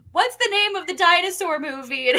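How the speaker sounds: high, loud imitation of a promo line; mock excited question. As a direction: blurt it out high, like quoting a skit.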